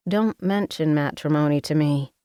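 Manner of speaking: ordinary delivery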